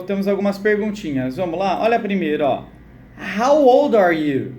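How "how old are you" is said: put-on slow, louder "How old are you?"; slow model reading. Shown as a demonstration. Teacher to students repeating, slow projected model question. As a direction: spell out the question word by word.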